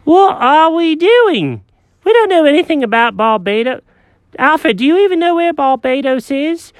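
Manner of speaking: high, fussy cat voice; Jenkins the cat. Acted.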